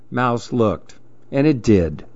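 ordinary delivery, nothing marked

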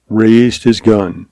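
plain reading voice

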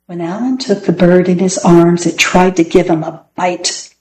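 ordinary delivery, nothing marked

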